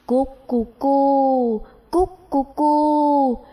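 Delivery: slow, drawn-out cooing bird call; dove cooing. Acted. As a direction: coo it like a dove. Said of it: "Nói rồi chim vỗ cánh bay đi". (tiếng chim cu gáy chậm rãi)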